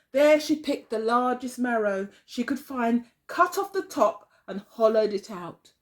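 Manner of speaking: plain reading voice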